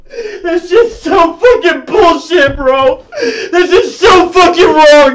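slow, loud sobbing outburst with a broken laugh; crying shout. Real, not acted. Streamer despairing over lost money, sobbing shout. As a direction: wail it through the tears.

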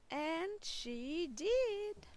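drawn-out final line; a slow satisfied close; slow ending. A narrator's reading. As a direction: land the ending slowly. Story ending, slowed.

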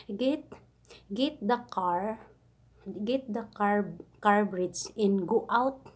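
very slow, deliberate broken English command; imitating the boss. Maid mimics her Saudi boss ordering her to fetch the car. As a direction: drag out every word of the order. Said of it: "Ganito ang pagkasabi".

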